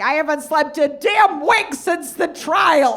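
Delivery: high, loud, slow over-gushing liberal mom. Retold or imitated. Daughter mimics her mom fawning over a cab driver's name, gushing high. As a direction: savor the name like a fine wine, way up high. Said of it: "She's like".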